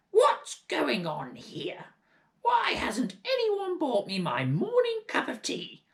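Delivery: put-on high, loud old hag voice; shouting Grandma. Grandma scolding the family for no tea, loud hag shout. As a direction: bellow down like a cranky giantess. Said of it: "and she shouted".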